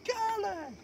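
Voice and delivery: very high, shouted hype-man call; [name]. Retold or imitated.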